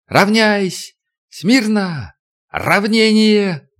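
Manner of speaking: high, barked parade commands; shouting drill sergeant. Acted. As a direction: yell the drill orders.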